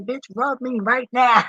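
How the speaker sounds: high, slow, demanding dog; bossy dog voice. Pretend. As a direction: boss the owner around as the dog. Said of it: "She like".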